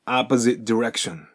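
"opposite direction" with the final T dropped into D; T swallowed by D. Shown as a demonstration. Teacher shows T absorbed into direction. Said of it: "後ろが前を飲みますから".

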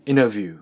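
"インタビュー" as innerview; American dropped T. Shown as a demonstration. Teacher contrasts it with katakana, "インタビュー" as innerview. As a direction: drop the T in "インタビュー". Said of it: "インタビューじゃなくて".

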